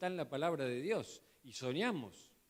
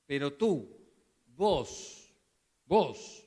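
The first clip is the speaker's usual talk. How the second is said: louder, pointing at the listener; emphatic preaching; 8 dB louder, much slower than usual. Acted. Preacher pointing at each listener as Paul, emphatic. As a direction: drive home the word vos.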